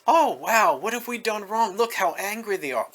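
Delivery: hammed-up worried parent; mock flustered parent. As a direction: play a panicky parent fussing over a child. Mock flustered parent placating protesters.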